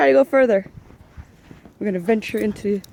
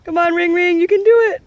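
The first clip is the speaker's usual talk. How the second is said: high, loud encouraging call; cheering on; 7 semitones higher, 4 dB louder than usual. Real, not acted. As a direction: cheer the dog on with energy. Cheering on the dog.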